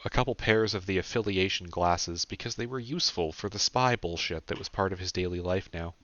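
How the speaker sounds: plain reading voice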